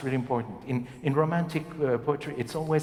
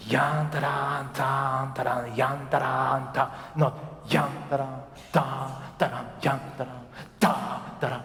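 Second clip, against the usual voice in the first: yan syllables punched in the line; wrong stressed rhythm. Shown as a demonstration. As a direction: hammer a heavy beat on yan. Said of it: "No, no, no. That's not French".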